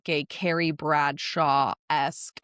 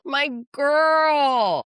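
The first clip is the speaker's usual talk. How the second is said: high, loud delighted squeal; loud, proud shout; excited cheer again; 8 semitones higher, 5 dB louder than usual. Real, not acted. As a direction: celebrate her at full volume. Repeated cheer.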